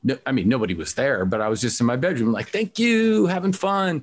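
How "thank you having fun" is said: put-on child performer. Kid thanking imaginary concert crowd.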